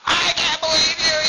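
slow, high-pitched mother's scream; mad screaming. Acted. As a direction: screech in fury at your child, drawn out. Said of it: "gets mad and starts screaming at him".

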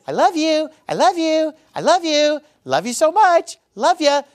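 high, sing-song string of I love you; mock gushing. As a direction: gush it out like an empty platitude. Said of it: "one of them isn't just".

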